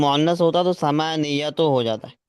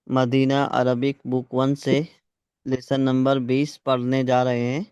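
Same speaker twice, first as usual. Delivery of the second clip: usual speaking voice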